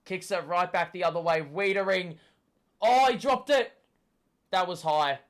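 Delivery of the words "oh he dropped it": loud dismayed cry; frustrated shout. Real, not acted. Fan reacting to dropped mark.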